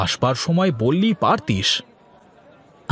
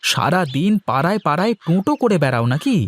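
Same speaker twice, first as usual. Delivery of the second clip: sharp, high nagging wife; a wife's shrill-free high scolding; 6 semitones higher than usual. Acted. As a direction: scold him for wandering off all day. Wife scolds husband coming home.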